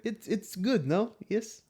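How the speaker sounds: put-on high, questioning Russian-accented voice. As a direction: ham up a Russian accent, rising. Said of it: "Can you do a Russian accent?".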